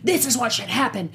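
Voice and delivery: like an outraged fan at a con; excited fan rant. Retold or imitated. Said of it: "people run up to me like".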